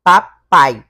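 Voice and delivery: "papai" loud, pa-pai, with a and i joined; "papai" punched out, final ai as one glide; syllable demonstration. Shown as a demonstration. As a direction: sound out "papai" in two firm syllables. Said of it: "O A fica junto com o I".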